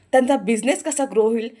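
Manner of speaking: scolding voice pushing him to grow up; loud lecture. Acted. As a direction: hammer home what he should do. Aarti lecturing loudly.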